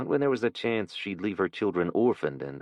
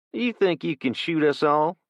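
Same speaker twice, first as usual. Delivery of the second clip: put-on sneering teen thug; 9 semitones higher, 4 dB louder than usual.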